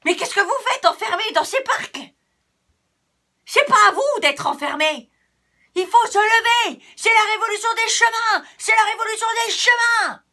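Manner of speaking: a little path shouting a rebellion; rallying cry. Acted. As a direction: rouse the crowd to rise up. Path calls again to revolt.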